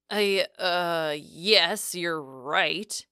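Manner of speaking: halting, stammered concession; stammering. A narrator's reading. Boyfriend backing down to her, stammering. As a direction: stumble into agreeing, with hesitations. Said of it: "he stammered".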